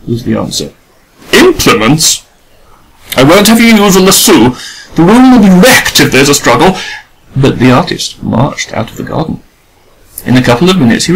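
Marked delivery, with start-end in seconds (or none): 1.3-6.8 a lady fearing her room gets wrecked; alarmed lady. A narrator's reading. Adela voiced high.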